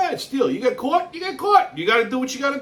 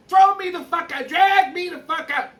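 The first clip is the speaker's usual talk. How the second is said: higher, pitched-up mock outburst; mock tantrum; 7 semitones higher than usual. Woman yelling at the cop, hammed-up higher mock tantrum. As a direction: imitate a woman losing it at a cop. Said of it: "Loses her fucking mind".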